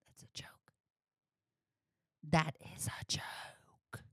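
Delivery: whispered disbelief, barely voiced. Real, not acted. Streamer reacting to the show, in a whisper. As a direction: breathe it out in disbelief.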